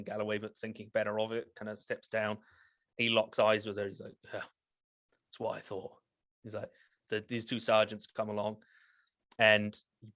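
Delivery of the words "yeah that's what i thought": soft, slow and smug; the captain stares her down and quietly gloats; quiet menace. Acted.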